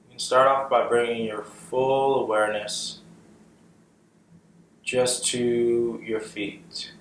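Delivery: guided meditation. Acted. Guiding listeners' awareness up from the feet, calm meditation voice. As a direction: lead them gently through the body, unhurried. Leads a body scan meditation.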